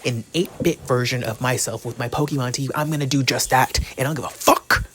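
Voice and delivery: loud, forceful declaration; theatrical full-volume defiance; defiant loud rant. Pretend. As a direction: hammer it out at your critics.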